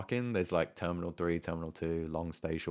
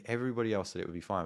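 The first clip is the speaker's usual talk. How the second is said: normal talking voice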